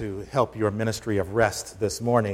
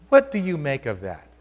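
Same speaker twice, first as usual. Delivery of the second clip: ordinary talk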